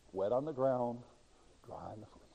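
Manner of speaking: usual speaking voice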